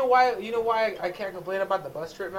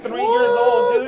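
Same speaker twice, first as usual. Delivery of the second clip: laughing; 8 dB louder, much slower than usual. Real, not acted. Laughing disbelief at the story.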